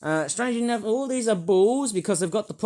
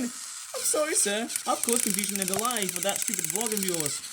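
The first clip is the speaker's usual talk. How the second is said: Thomas the Tank voice; 2 semitones higher, 5 dB quieter than usual. Acted. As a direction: play a sheepish little train saying sorry. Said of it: "Thomas the Tank has derailed again".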